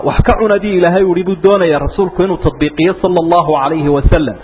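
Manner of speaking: ordinary talk, nothing marked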